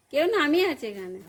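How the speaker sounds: loud call to someone in another room. Real, not acted. To the father-in-law who asked who she's talking to, loud call. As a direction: raise the voice so he hears you.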